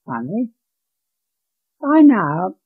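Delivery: ordinary delivery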